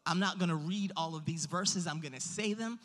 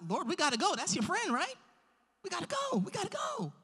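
high-pitched mock pleading; urgent disciples; 11 semitones higher than usual. Disciples urging their Lord to leave for Lazarus, high urgent pleading. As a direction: pitch climbs into squeaky, insistent urging.

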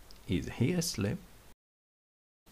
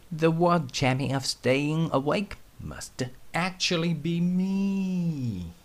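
higher boastful toy voice; boastful frog; 9 semitones higher than usual. Acted. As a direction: brag like a tiny frog.

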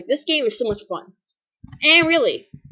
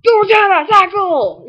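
high, loud trainer shout; shouted command; 8 semitones higher, 9 dB louder than usual. Pretend. Kid shouting a battle command at Rattata. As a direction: yell the attack order.

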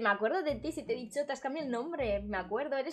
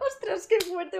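higher and louder, amazed; excited exclamation; 8 semitones higher, 6 dB louder, slower than usual. Real, not acted. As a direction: react with delighted surprise. Excited reaction to chat. (exclamación emocionada)

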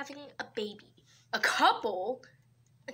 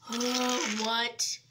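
ordinary talk

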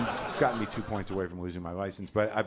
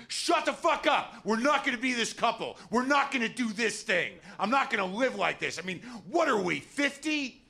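exploding; 14 semitones higher than usual. Retold or imitated. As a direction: blow up at her in a rant. Snapping at wife over crosswalk.

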